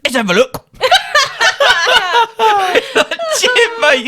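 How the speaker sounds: high, giggling through the punchline; can barely get it out for laughing; telling the punchline to friends, helpless laughter. Retold or imitated.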